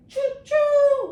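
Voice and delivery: high, loud choo choo whistle; train whistle. Shown as a demonstration. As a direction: toot it like a steam train. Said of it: "like a train".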